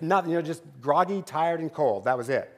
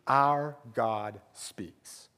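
1 slow emphatic; 6 semitones lower, 5 dB quieter, much slower than usual. Acted. Sermon key line, slowed.

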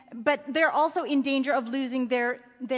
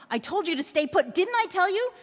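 raised, urgent voice; scolding father; 6 semitones higher, 4 dB louder than usual. A narrator's reading. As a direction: tell off a child in alarm. Father scolds his son.

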